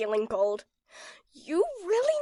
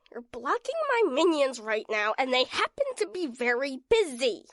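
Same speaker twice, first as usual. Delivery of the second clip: normal talking voice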